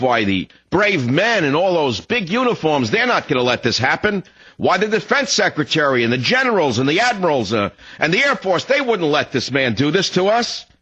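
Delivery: high, singsong mock confidence; swooping, wishful inner voice; naive self-reassurance. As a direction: insist hopefully that someone will step in. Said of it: "I say to myself".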